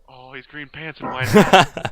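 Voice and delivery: hammed-up detective sizing someone up, slow; mock suspicious. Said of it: "You're like".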